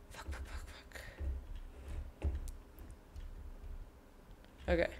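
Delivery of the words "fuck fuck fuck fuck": breathless whispered swearing under pressure; whispered curses. Real, not acted. Gamer rushing a timed puzzle, whispered panicked swearing.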